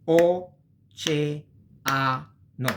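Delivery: O, ce, a, no split into separate syllables; Italian teacher breaking the word into syllables. Shown as a demonstration. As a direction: spell out O ce a no slowly by syllable. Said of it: "Oceano means ocean".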